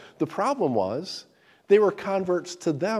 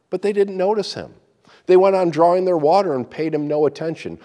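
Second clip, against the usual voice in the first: lively, louder storytelling read; story narration; 6 dB louder than usual. Acted. Preacher reading a donkey fable aloud, dramatic.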